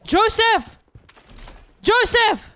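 high, urgent cries; excited calling out. Real, not acted. Gamer calls Joseph to stop, excited high cries. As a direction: call out a name in high-pitched excitement.

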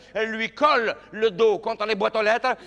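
ordinary talk

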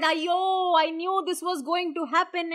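high-pitched anguished cry; acted screaming lament. A narrator's reading. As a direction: wail it high, as disaster strikes. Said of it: "Savitri screamed and said".

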